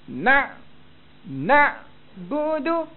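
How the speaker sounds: "na'budu" high, loud, straining hard on the ain; over-pressed ain. Shown as a demonstration. Teacher shows what not to do, overdone ain in "na'budu". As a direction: squeeze and hold the ain in "na'budu", very slowly. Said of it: "Jangan tekan sangat huruf ain".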